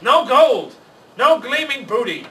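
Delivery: loud, high outraged pirate roar; furious pirate. A narrator's reading. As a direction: bellow in frustration at the empty loot.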